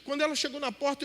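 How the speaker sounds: ordinary talk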